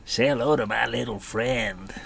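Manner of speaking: hammed-up film gangster impression; Tony Montana. Storyteller quoting Scarface to listeners, hammed-up gangster impression. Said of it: "you've all seen Scarface".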